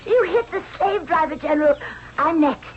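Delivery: put-on high, thrilled children; excited. Children cheer as the general hits the overseer, high and thrilled. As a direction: cheer the lucky shot.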